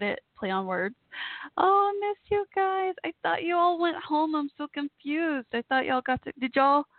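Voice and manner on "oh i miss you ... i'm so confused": emotional longing. Real, not acted. Emotional reunion with soldiers she missed.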